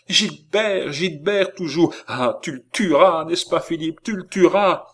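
high, frantic outcry; supplication affolée. A narrator's reading. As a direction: urge your brother on, high and forceful. Girl begs brother to kill Gilbert. (supplication affolée, voix aiguë et forte)

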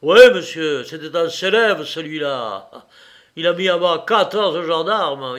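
loud, proud boast; proudly emphatic, punched opening; flash of pride. A narrator's reading. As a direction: boast of your famous brother. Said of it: "eut un éclair de fierté".

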